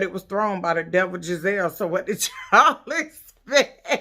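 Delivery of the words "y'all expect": slow, laughing mock question; laughing at the party's drama. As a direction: chuckle through the question. Mocking the pampering party.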